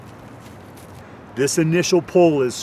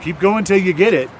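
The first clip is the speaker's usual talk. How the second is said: urging shout; 5 semitones higher, 6 dB louder than usual. Real, not acted. Instructor urging trainees mid-drill.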